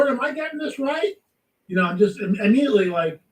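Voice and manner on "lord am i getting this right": high, rising questioning to God; puzzled plea. Retold or imitated. Woman asking the Lord about the word she got, puzzled. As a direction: re-enact an unsure, pitched-up check-in.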